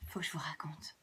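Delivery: low confiding whisper; softly whispered aside to the children; whispered secret. A narrator's reading. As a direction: share it like a secret. Confiding to listeners.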